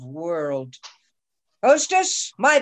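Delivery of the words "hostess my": rising call across the tavern. Acted. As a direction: call out to the hostess, higher. Falstaff calls out for breakfast.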